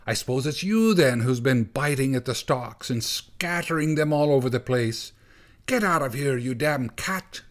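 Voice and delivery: high, scolding tirade; bursting out in anger. Acted. Gorsh scolds the cat for picking his tomatoes, angry. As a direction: let the pent-up anger spill out. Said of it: "came bursting out at once".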